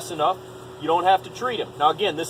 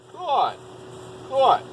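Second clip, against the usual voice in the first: squeaky praising a dog; 6 semitones higher than usual. Real, not acted. Praising dog after sit.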